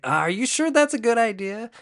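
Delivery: high, loud questioning squeal; doubtful mock question. Voices Anakin doubting Yoda's plan.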